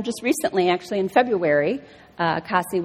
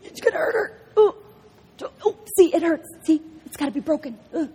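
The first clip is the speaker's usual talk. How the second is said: high, pleading worried mother; hammed-up fretting mom; anxious mom; 9 semitones higher, faster than usual. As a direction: beg the doctor to stop, panicky. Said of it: "I'm sitting there as a mom".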